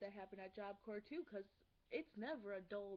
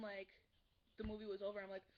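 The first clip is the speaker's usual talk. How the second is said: ordinary talk, nothing marked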